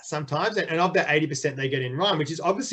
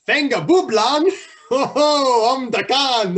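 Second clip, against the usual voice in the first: loud, high, drawn-out mock-Dutch reading; shouty pretend-Dutch, slow and high; 9 semitones higher, 7 dB louder, slower than usual. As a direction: ham up a Dutch accent, laughing through it. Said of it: "I think maybe Dutch version of that".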